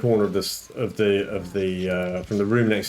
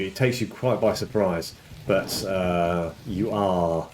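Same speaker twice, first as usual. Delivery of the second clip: ordinary delivery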